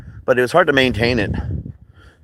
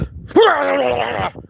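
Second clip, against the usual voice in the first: high, sustained manic cackle; fake psychotic laugh; 14 semitones higher than usual. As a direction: burst into a long, shrieking mock-crazy laugh. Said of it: "I'm not really psychotic".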